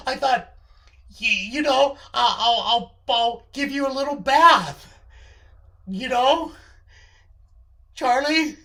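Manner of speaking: mildly flustered, searching for approval; flustered excuse. Acted. Acted stammering excuse to Charlie.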